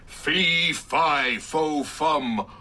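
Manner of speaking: booming fairy-tale chant, word by word; sarcastic storybook magic words; mock incantation. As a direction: mockingly cast a spell, drawn out. Mock spell to open the lockup.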